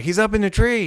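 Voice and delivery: high-pitched retold call of a sighting; mock excited cry. Recalling when they spotted it in the tree, high mock cry.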